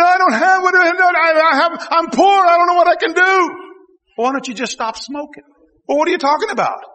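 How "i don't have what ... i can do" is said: higher, louder voicing of people who complain they're poor; imitating a poor person's complaint; mock whiny complaint. Preacher voicing poor churchgoers, a mock complaining whine.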